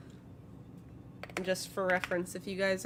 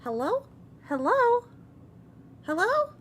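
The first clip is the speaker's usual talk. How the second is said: high, small voice calling out; a tiny voice calling from the stone; little voice calling hello; 8 dB louder than usual. Acted. DM voicing a tiny voice calling from a pocket, high and searching. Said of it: "hear a little voice going".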